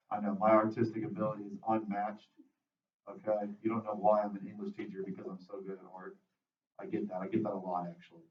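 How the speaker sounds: low, very soft deadpan brag; quiet, low-pitched tongue-in-cheek boasting; deadpan mock boast. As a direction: brag drily about your art, under your breath. Mock boast about own drawing.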